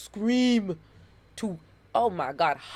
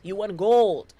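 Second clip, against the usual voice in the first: louder, punching GOLD like an announcer; triumphant announcement; 9 dB louder, slower than usual. Shown as a demonstration. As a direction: call it out like a sports announcer crowning the winner. Repeats the line, second time bigger.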